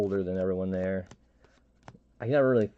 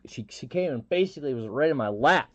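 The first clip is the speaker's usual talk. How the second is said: ordinary talk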